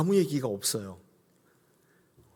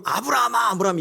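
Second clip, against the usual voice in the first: loud, high startled cry; startled calling; 9 semitones higher, 5 dB louder than usual. Acted. Startled God calling to Abraham, alarmed cry. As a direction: call out the name in alarm. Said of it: "얼마나 놀라셨는지를 보여주는".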